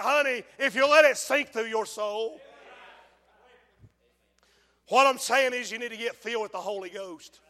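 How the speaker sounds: normal talking voice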